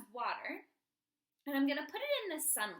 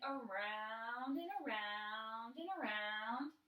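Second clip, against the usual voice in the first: slow, low, looping "around and around"; drawn-out circling; 5 semitones lower, 4 dB quieter, much slower than usual. Shown as a demonstration. Teacher showing how bike wheels spin, drawn out and circling. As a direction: stretch each "around" like a turning wheel.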